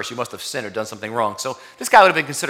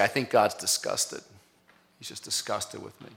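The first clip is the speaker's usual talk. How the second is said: ashamed confession; 4 semitones lower, 8 dB quieter than usual. Retold or imitated. Congregant imagines God's disgust, hushed and ashamed. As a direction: confess it under your breath. Voicing congregant's shameful thought.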